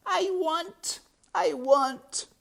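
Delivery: very high, loud wail; sobbing. A narrator's reading. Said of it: "sobbed Meg".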